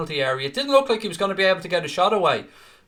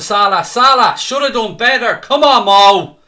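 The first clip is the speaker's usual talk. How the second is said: loud, much higher yell that builds to a drawn-out shout; frustrated yell; 7 semitones higher, 13 dB louder, slower than usual. Real, not acted. Fan on stream yelling at Salah after the miss, loud frustrated shout. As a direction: roar at Mo to finish it.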